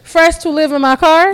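sarcastic. Acted. Sarcastic echo.

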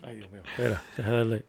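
breathless from laughing; gasping after a laughing fit; out of breath. Real, not acted. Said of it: "déjame respirar".

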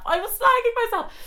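very high squeaky mock protest; shrieky, exaggerated protest way up high; high squeal. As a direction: squeal it in comic self-defence. Repeats protest in squeaky pitch.

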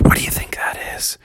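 quick, hushed whisper; nervous whisper. Acted. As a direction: whisper it to your friends. Frightened kids at the cave.

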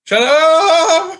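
high, loud disbelieving cry; playful shout. Real, not acted. Friend reacting to an absurd claim, loud playful shout. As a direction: yell it in mock outrage.

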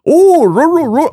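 high, loud mock-Finnish gibberish; hammed-up booming self-talk; loud Finnish mountain man. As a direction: holler nonsense like a man loudly talking to himself. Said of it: "loudly talks to himself".

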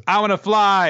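put-on high, loud eager child's cry; a reckless girl on the roof, gleefully shouting; excited cry. Flashback, Mimin goes.

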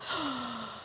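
acted gasp of amazement; astonished gasp. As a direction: suck in air in mock astonishment. Acts out girl's amazed reaction.

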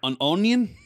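loud, high mock Australian bafflement. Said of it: "They're probably just like".

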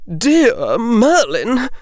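slow, lightly shaky aristocratic lady; a hint of a shaken grand lady, drawn out; unsteady noblewoman. A narrator's reading. Mother stunned watching her daughter duel, lightly unsteady. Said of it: "Her voice seemed unsteady".

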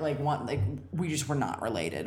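ordinary talk, nothing marked

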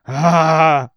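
loud high anguished scream; scream of horror. Acted. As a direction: cry out in pure horror. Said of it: "he screamed".

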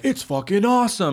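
mocking someone who pumps themselves up; mock hype. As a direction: fake enthusiasm, overselling it. Mock pumped-up answer.

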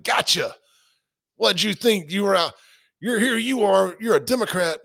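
high, smug taunting reveal; imitating a smug journalist springing the trap; mock gotcha taunt.

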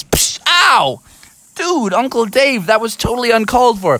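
Froggy complaining to Uncle Dave; mock hurt. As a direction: complain about being kicked. Froggy kicked in the face.

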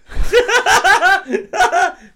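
high squealing laugh; loud burst of laughter. Real, not acted. Laugh after punchline.

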